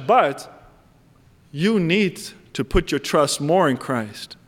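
ordinary talk, nothing marked

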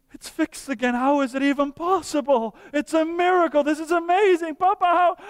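put-on a wide-eyed kid who can't believe the fix; amazed little boy. Little boy marveling as Papa fixes his car, rising amazement.

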